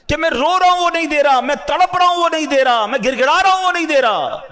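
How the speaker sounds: rising lament, line after line; desperate plea. Acted. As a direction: cry it out like someone begging unanswered. Voicing a desperate pleading believer.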